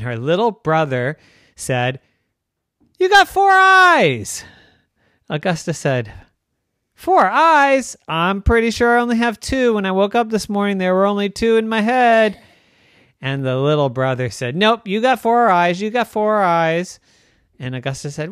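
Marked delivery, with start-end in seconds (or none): 3.0-4.4 high, loud, slow taunt; singsong jeer at his sister; much higher and louder bratty kid voice; teasing little brother. Acted. 6.9-12.4 put-on high, loud, puzzled child voice; baffled protest at the teasing; indignant little girl. 14.5-17.0 put-on high, louder kid taunt; taunting little brother. Brother keeps teasing.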